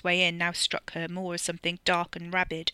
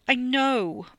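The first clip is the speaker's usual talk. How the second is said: high, loud near-wail; 7 semitones higher, 5 dB louder than usual. A narrator's reading. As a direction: wail it, close to tears. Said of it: "Ollie almost wailed".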